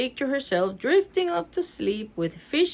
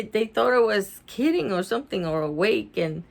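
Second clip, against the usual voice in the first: usual speaking voice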